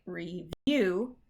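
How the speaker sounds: REVIEW as re-VIEW; re-VIEW, weight on the end; correct stress. Shown as a demonstration. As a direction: stress the second syllable of REVIEW. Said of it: "that first one is right".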